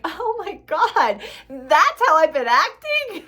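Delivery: high, loud, slow gasp of dismay; shocked realization. Retold or imitated. Mom catching herself acting like her son, in high, loud shock. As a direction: drag out the horror of catching yourself.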